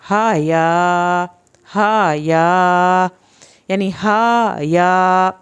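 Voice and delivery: "ہا یا" slowly, both long vowels held; lengthened vowels. Shown as a demonstration. Teacher showing how long to read the letters, slow "ہا یا". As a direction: stretch each syllable of "ہا یا" evenly. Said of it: "ہا کو آپ نے زبر سے لمبا".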